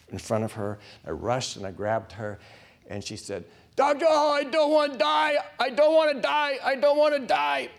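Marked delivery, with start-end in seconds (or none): 3.8-7.7 much higher, louder, panicked begging; a terrified dying woman pleading over and over; desperate pleading. Retold or imitated. Dying patient begging the chaplain.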